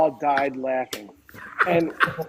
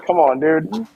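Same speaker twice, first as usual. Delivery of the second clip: exasperated "oh come on" tone; mock exasperation; 3 semitones higher, 5 dB louder, slower than usual. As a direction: plead with a friend, half-joking. Retold exasperation at noisy seatmate.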